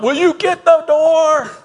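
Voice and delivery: high, loud, slow exasperated yell. Retold or imitated. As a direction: call out fed up that nobody answers. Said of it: "the voice would get louder".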